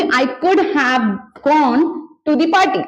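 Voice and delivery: slow, word by word; slow model sentence. Shown as a demonstration. Teacher first modelling the party taunt slowly. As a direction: say it slowly, each word apart. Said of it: "तो मैं बोलूंगी".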